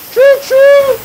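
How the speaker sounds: train whistle. Pretend. Cook imitates venting pressure cooker as train, loud whistle. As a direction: belt it out like a steam whistle. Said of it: "It's like a train coming down the track".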